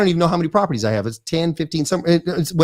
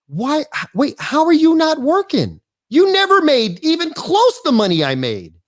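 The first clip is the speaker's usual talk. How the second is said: retelling a friend's shock, pitched up; mock disbelief; 8 semitones higher than usual. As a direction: act floored that he stopped working. Buddy shocked he retired early.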